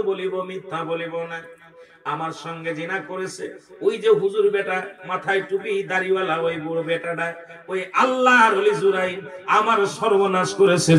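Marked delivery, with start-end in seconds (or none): none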